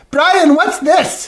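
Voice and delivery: put-on slow, high toddler voice asking; small child's curious question, drawn out; little girl voice. Stepdad retelling his stepdaughter asking him in the bathroom, high slow child voice.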